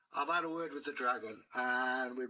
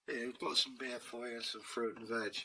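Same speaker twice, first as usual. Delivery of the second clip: plain reading voice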